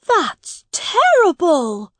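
put-on high, slow, dismayed young prince; a boy stunned by bad news; shocked boy king. A narrator's reading. Edward hears of Miles's prison years, high and slow.

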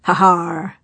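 loud gloating laugh; triumphant laugh. Acted. Blackheart triumphant.

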